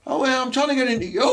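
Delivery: high, fast hammed-up mum; mock mum. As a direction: ham up the mum's come-on. Mum's punchline in bar.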